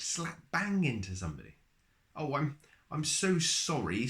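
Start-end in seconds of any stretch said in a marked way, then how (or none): 2.2-4.1 nervous stammer. A narrator's reading. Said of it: "stammered Mister Good nervously".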